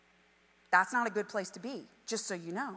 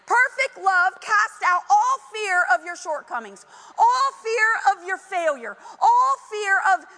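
rising to a loud, higher impassioned cry; building preaching cry; 7 semitones higher, 7 dB louder than usual. Real, not acted. Preacher building to a climax over fear, louder and higher. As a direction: build it up till it breaks into a shout.

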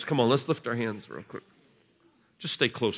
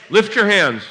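high call; 10 semitones higher, 9 dB louder than usual. Real, not acted. Preacher calling to kids at the altar, high and loud. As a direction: lift your hands, called high and loud.

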